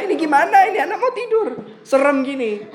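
high, loud, laughing disbelief; laughing shocked husband. Retold or imitated. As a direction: laugh through the husband's fright. Suami kaget lihat istri. (suami kaget sambil tertawa, suara tinggi)

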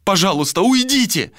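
high, desperate begging; pleading. A narrator's reading. Malika begging Vilar to leave, desperate plea. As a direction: beg urgently, rising high. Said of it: "взмолилась Малика".

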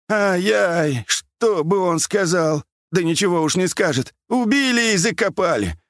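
put-on high-pitched old man's keening; high, plaintive wailing; whining lament. A narrator's reading. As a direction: moan and bewail the loss. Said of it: "старик продолжал ныть и причитать".

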